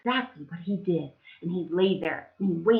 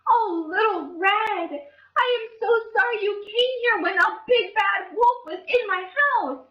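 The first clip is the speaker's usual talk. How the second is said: high fussing granny; sorry Granny; 13 semitones higher than usual. Acted. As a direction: comfort Little Red, all apologies. Granny apologizes.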